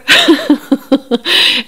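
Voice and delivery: loud burst of real laughter; big laugh. Real, not acted. Laughing at Cody's excuse to viewers, loud real laughter.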